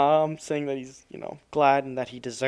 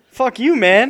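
mock shout; 10 semitones higher, 11 dB louder than usual. Joining the mock heckle.

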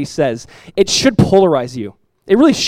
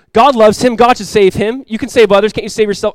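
higher, louder taunting jeers; mocking crowd; 6 semitones higher, 7 dB louder than usual. Preacher acting the crowd taunting Jesus on the cross, loud. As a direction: jeer at him like the scoffers.

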